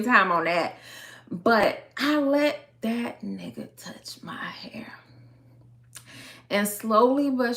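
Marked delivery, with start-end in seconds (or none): 2.8-4.9 slow, breath-only near whisper; hushed confession. Real, not acted. Storyteller confessing to her viewers, hushed. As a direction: drop to a slow whisper, confiding. Confiding a regretted mistake.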